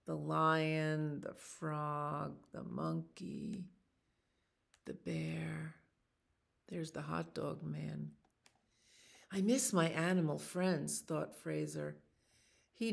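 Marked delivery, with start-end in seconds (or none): none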